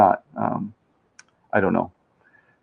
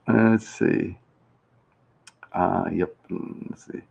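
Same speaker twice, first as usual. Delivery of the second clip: normal talking voice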